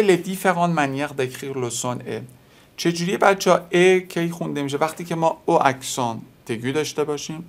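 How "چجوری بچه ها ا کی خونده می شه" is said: usual speaking voice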